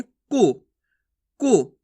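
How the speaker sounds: को said as a brief "ku"; short u on को, each separate; short vowel. Shown as a demonstration. Teacher modelling short u for learners, brief vowel. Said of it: "इसी प्रकार से करना".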